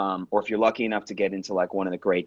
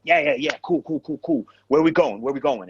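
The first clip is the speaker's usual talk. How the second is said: higher, jumpy repeated bursts; hyped up; 4 semitones higher than usual. Retold or imitated. Brushing off his sibling's "you okay", hyped up. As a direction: imitate someone agitated and wired. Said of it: "he's, like, really hyped up".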